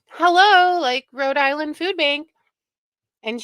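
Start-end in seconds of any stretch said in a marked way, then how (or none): 0.1-2.3 mock phone answer. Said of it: "ring, ring".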